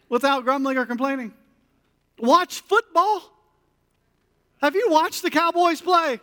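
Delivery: building mock outrage. Preacher hamming up his people's objections, rising mock outrage.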